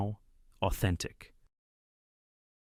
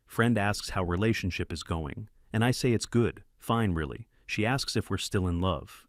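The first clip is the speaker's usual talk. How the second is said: plain reading voice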